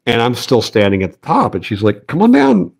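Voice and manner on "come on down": put-on high, bright call down to the kid; mom calling down. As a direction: call him down from below like mom. Mom calls son down escalator.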